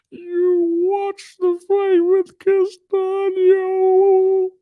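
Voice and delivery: high, very slow ghostly wail; Paul Bearer. Acted. Streamer mimicking Charlo critics in his chat, long high wail. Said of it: "Talking like Paul Bearer".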